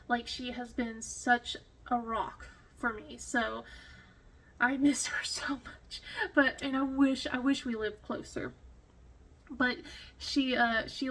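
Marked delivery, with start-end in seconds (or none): 4.6-6.0 tearful whisper. Real, not acted. Missing friend, tearful.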